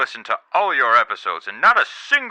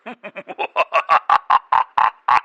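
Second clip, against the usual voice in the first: smug chuckle; 3 semitones higher than usual. Acted. President laughs at own joke.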